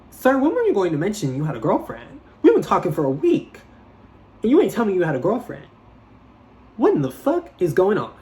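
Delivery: incredulous indignant rant; outraged disbelief. Retold or imitated. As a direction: call him out in shocked disbelief. Outraged at hidden girlfriend.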